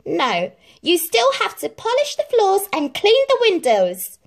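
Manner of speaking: high, loud commands; ordering stepmother. A narrator's reading. Stepmother orders Cinderella, high loud commands. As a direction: bark out the chores. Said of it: "ordered the stepmother".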